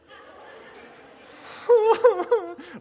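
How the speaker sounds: high, loud laughing fit; helpless laugh at the punchline; wild laughter. Real, not acted. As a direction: laugh until it almost cries. Laughing at own story.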